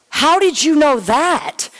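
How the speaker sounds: drawn-out astonished question; amazed disbelief. Retold or imitated.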